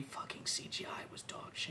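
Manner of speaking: hushed whisper, almost no voice. Real, not acted. Griping to a friend about bad CGI, in a whisper. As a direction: mutter the verdict under your breath.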